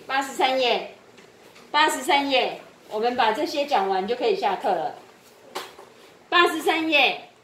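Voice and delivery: loud, projected teacher announcement; calling out to class. Real, not acted. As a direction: call it out loudly across the classroom.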